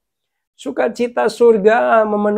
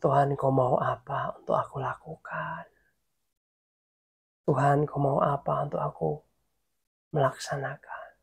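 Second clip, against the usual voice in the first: low, hushed, half-whispered question to God; soft intimate prayer; 6 semitones lower, 10 dB quieter than usual. Shown as a demonstration. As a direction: ask gently, dropping into a whisper. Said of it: "kamu hanya ngomong lirih sama Tuhan".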